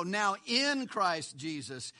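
slow phrase with "in" stretched out; stress on IN. Shown as a demonstration. Preacher showing why the word matters, stress on "in". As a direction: land hard and long on "in". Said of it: "The Holy Spirit breathes the word".

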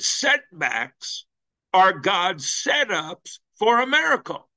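rising high on the payoff; climactic punch line. Retold or imitated. Pastor quoting the missionary's word to the church, rising climax. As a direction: land the miracle line.

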